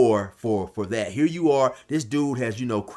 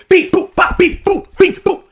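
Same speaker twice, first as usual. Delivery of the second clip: high, loud mouth beeps for fighting; beep boop noises; 11 dB louder than usual. Retold or imitated. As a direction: beep out the brawl playfully. Fight sounds censored by mouth.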